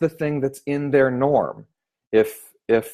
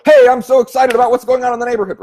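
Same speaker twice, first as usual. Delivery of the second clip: much higher, louder and fast; hyped pitch; 13 semitones higher, 6 dB louder, faster than usual. Shown as a demonstration. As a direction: gush with overblown excitement. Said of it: "didn't feel appropriate".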